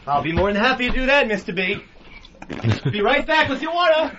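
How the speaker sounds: a hint of an eager old-timey intern; chipper, fast, overly polite; 1920s intern. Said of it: "talking like an intern from the twenties".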